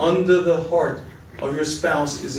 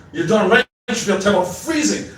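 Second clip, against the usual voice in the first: wife left waiting forty minutes in the cold, angry; 3 semitones higher than usual. Acted. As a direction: throw his words back at him. Wife snaps back at husband.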